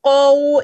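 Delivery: क़ौ drawn out and loud; held qau. Shown as a demonstration. Teacher showing students how to hold क़ौ. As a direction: give क़ौ time. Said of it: "इसको time दें".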